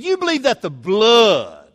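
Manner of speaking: loud, high, hugely drawn-out BLOOD; slow, exaggerated mock distaste on "blood"; mocking disdain. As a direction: imitate a scornful rival, stretching "blood" out. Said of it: "that's the way he said it".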